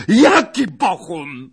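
loud, high, drawn-out demand; yelling. Acted. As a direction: shout the question in disbelief. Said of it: "wrzasnął pan Zagloba, trząsąc Pacholkiem". (wrzask niedowierzania)

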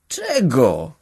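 usual speaking voice

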